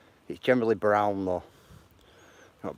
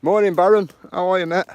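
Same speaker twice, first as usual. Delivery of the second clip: loud greeting; 11 semitones higher, 14 dB louder than usual. Real, not acted. Greeting live chat, calling out.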